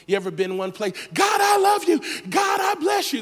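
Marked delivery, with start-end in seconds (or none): 1.1-3.2 ecstatic praise. Acted. Worshipper on a high day.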